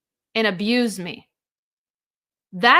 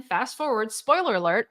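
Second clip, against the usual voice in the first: usual speaking voice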